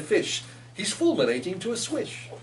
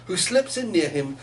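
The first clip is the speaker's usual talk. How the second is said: ordinary delivery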